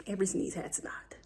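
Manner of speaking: hushed unvoiced whisper. Real, not acted. Mom whispering to viewers about her sneezing kid, hushed. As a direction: whisper it so the house stays quiet.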